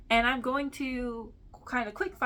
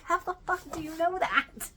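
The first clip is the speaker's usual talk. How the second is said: higher, incredulous student voice; mock student shock; 8 semitones higher than usual. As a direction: play the startled student, hammed up. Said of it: "And they're like".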